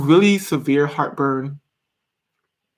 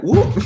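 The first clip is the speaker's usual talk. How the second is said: quick high whoop; playful sound effect, pitched up; imitated whoop; 10 semitones higher, 4 dB louder than usual. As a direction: make a swift rising whoop sound. Imitated noise of pulling bottle out.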